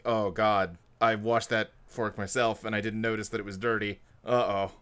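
softly voiced mild dismay; low, dismayed realisation. [name] noticing her washed fork is dirty, quietly dismayed. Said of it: "gives [name] a moment of".

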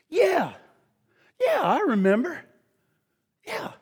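a hint of a sly bystander, hushed; accuser recognizing Peter.

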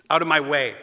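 loud, shoved-aside bully yell; angry kid shouting out of my way; rude shout. Acted. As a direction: bark it at someone in your way. Rude kid pushing in line.

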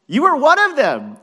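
high, shouted accusation; acted crowd member calling him out; insistent accusation. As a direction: confront him at full volume. Bystanders accuse Peter.

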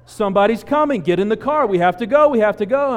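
loud, high, panicked yelling; panicked screaming. Retold or imitated. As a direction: shout it like you're terrified for your life. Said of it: "the girls are screaming from inside the car".

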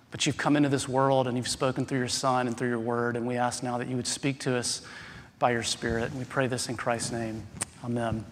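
soft, unhurried spoken prayer; hushed pastor's prayer. Real, not acted. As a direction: pray quietly and earnestly before the sermon. Pastor's opening prayer, quiet and slow.

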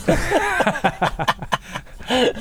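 long, high burst of laughter; helpless high laughing fit; real laughter. Real, not acted. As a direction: crack up for a couple of seconds. Laughing at the pavement joke.

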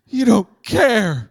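loud, high, very slow drawn-out whine; milk the despair like Peter; melodramatic whine. Retold or imitated. Peter accusing Jesus in the storm, melodramatic drawn-out whine.

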